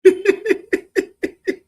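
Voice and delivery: sudden high-pitched burst of laughter; loud high laugh. Real, not acted. Host bursts out laughing at the interview clip. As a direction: howl with laughter.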